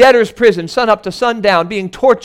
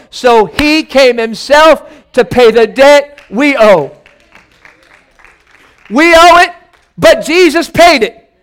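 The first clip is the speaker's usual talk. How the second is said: fervent preaching; 2 semitones higher, 5 dB louder than usual. Acted. Preacher pleads the gospel to his church, loud and fervent. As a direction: preach it hard to the congregation.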